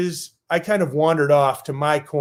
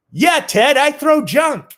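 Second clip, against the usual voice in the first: high bluffing voice; 11 semitones higher, 4 dB louder, slower than usual. Retold or imitated. Bluffing to Ted Williams.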